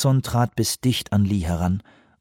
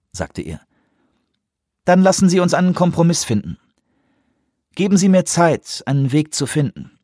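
a higher, pressing official voice; Lee, firm appeal; 7 semitones higher, 3 dB louder than usual. Acted. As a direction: make him weigh his responsibility. Lee's final appeal.

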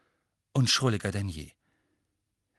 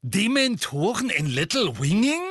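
highly surprised; 8 semitones higher, 5 dB louder than usual. A narrator's reading. Madame Bones hearing of dementors, highly surprised. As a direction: voice jumps up in astonished disbelief. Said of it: "höchst überrascht".

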